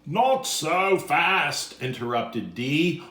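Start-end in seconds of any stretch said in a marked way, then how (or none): 0.0-1.8 slow, louder halt; firm interruption. Acted. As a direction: stop them firmly.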